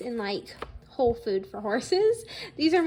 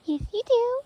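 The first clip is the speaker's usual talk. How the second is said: high sing-song to the cat; pet talk; 9 semitones higher than usual. Real, not acted. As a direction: coo back at the cat. Talking to the cat.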